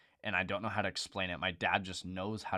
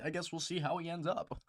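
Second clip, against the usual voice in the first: higher, unbothered dad voice through a laugh; imitating dad; 11 semitones higher than usual. Son imitating his unbothered dad, amused. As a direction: play the unfazed dad, half laughing. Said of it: "He's just like".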